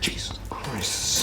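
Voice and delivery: hushed aside under the breath; whispering. Real, not acted. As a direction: whisper it to yourself. Muttering while grating garlic.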